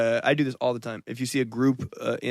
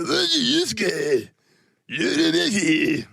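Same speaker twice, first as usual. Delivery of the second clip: high, very slow, hammed-up dub line; imitating Kuwabara; 8 semitones higher, much slower than usual. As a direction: drag every word out like a cartoon dub. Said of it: "And you go".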